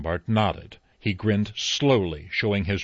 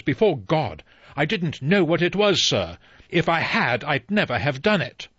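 rising, louder "I didn't know what it was" pleading his innocence; desperate protest; 7 semitones higher, 4 dB louder than usual. Acted. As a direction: plead before God, a touch louder. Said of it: "Rogers cried".

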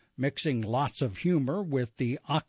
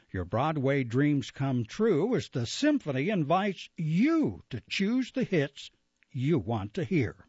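ordinary talk, nothing marked

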